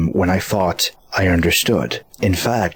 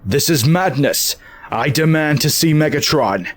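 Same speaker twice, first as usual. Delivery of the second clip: higher-pitched, indignant protest; indignant demand; 6 semitones higher than usual. Acted. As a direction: demand it, voice lifted in outrage.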